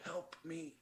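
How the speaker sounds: barely audible dying plea; faint plea. Acted. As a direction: breathe it out with the last strand of life. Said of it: "he's like".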